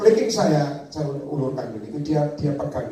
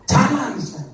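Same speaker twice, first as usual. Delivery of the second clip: God's command; 8 semitones higher, 6 dB louder than usual. Acted. God commands, acted dialogue.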